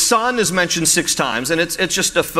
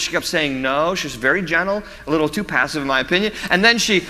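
normal talking voice

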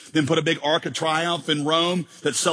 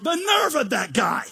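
high-pitched, affronted outburst; mock indignant; 7 semitones higher than usual. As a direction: huff in hammed-up outrage. Acts indignant complaint about God.

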